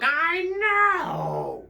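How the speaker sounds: high, loud puppet squeal; a drawn-out, delighted puppet cry; excited puppet. Acted. Puppet answers the host excitedly.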